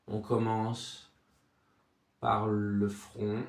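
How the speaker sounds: soft, low meditation voice with long silences; guided body scan. Acted. Sophrology guide takes listener from forehead to chest, soothing guided relaxation.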